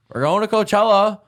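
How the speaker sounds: high, loud party-hype call; imitating pumped-up roommates; hyped announcement. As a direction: announce it like the trip of the year.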